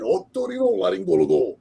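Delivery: retold otorrinolaryngologo with a mock Japanese accent. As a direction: repeat the Spanish word with a playful Japanese accent. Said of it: "a Spanish word that I said in Japanese accent".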